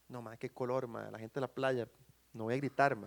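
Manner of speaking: low, soft, reluctant mumble; mock embarrassment. Preacher mocks drowning swimmer shy of beachgoers, embarrassed. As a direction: shrink back, pretending to be too shy.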